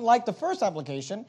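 normal talking voice

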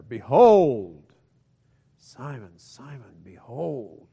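grab attention with behold, then pull in close; dramatic call. Shown as a demonstration. Jesus grabbing Simon's attention, loud then hushed. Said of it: "that is an attention grabber".

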